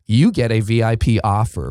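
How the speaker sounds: ordinary talk, nothing marked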